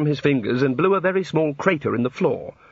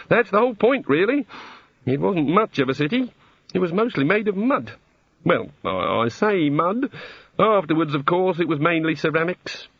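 higher-pitched, dithering deity; rueful god; 5 semitones higher than usual. A narrator's reading. God explaining a destroyed city, rueful. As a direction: explain awkwardly, lifting the ends of phrases.

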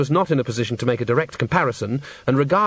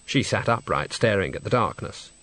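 plain reading voice

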